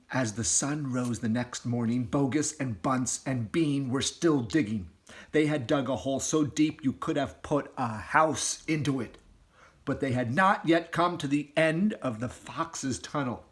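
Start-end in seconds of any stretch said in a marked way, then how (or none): none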